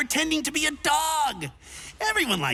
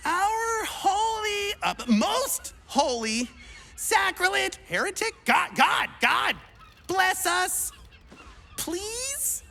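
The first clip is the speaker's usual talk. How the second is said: high, drawn-out mock prayer; fumbling prayer; 6 semitones higher than usual. Would-be cleric praying to a god he can't name. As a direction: pray grandly and lose the words. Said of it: "I must pray".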